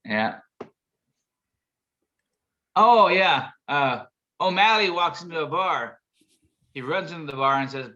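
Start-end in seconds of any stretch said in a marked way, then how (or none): none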